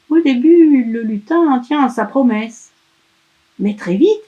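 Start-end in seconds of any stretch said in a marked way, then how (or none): none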